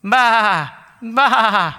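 a mild mock sheep cry; sheep bleating. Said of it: "You would do what a sheep does".

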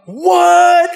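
very high, loud drawn-out exclamation; hammed-up shriek of disbelief; shocked. Driver reacting to the sudden fix, loud shock.

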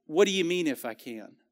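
tender, caring question. Shown as a demonstration. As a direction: gently lead him to think. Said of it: "I feel like it was softer".

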